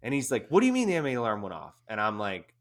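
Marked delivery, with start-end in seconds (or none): 0.5-1.8 fast, louder, higher alarmed demand; panicked question. Retold or imitated. Buddy demanding answers about the chemical alarm, panicky. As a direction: rattle it off in rising panic. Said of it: "I can hear it in his voice".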